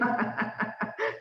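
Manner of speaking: real laugh, kept light; amused laugh. Real, not acted. Coach reacting to Mary's car problem, amused laugh.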